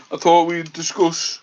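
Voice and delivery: ordinary talk, nothing marked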